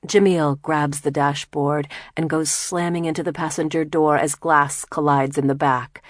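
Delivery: ordinary talk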